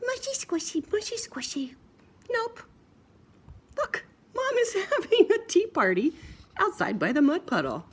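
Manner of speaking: very high tiny pig; cheeky stubborn piggy; squeaky piggy voice. Acted. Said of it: "But Piggy answered".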